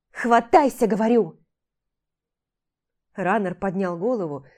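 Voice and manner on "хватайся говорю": loud firm order; insistent shout. Acted. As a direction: demand it. Jim insists.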